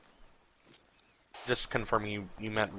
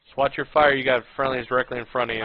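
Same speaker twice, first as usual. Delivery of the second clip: loud, fast warning call; urgent shout; 3 semitones higher, 9 dB louder, faster than usual. Real, not acted. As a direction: yell it to stop the shooting. Urgent warning over radio.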